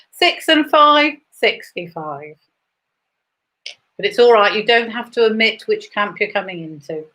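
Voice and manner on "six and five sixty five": bingo caller. Acted. Bingo calls between chat.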